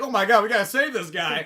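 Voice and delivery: hammed-up alarm from concerned onlookers; alarmed bystanders. Poor-district people rallying to save a man, mock alarm. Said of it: "a poor district, people would be like".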